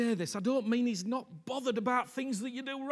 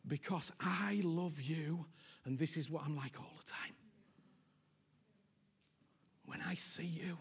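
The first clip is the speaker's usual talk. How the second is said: tender whisper; 5 semitones lower, 11 dB quieter than usual. Acted. Jesus whispering his love to the listener.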